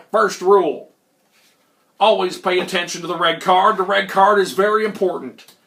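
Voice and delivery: put-on folksy old hustler voice; Old Man McGillicuddy. Old hustler explaining the game rules, emphatic character voice. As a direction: lecture like a wily old card sharp. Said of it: "they called him Old Man McGillicuddy".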